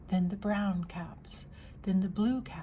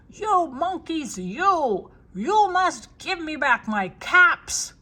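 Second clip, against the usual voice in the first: high, loud, drawn-out storybook rage; very angry shout; 8 semitones higher, 11 dB louder, slower than usual. A narrator's reading. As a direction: shout it in a stamping tantrum. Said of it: "He stamped both his feet and shouted".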